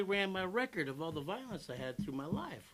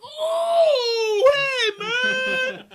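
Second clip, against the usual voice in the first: very high, loud, hammed-up "oh hey man!"; shouted pretend surprise, slow on "nice to see you"; fake surprise; 14 dB louder, much slower than usual. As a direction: overact running into a friend by chance. Fake surprised greeting, staged coincidence.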